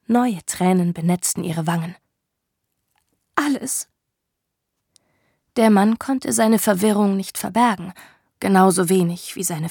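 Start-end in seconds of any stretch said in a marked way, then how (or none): 3.3-3.9 higher, fragile and broken; broken whisper of grief. Acted. Woman answering the man, broken and high. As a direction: let the word fall apart in grief.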